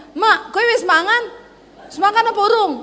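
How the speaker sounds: high-pitched question, retold; nada kasar. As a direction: ask mother-in-law too high, comically blunt. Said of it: "Ojo ngomong gitu tuh kasar". (bertanya dengan nada tinggi dan kasar)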